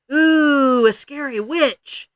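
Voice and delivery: loud, slow fake fright; mock scared. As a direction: pretend to be scared. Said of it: "rather absent-mindedly".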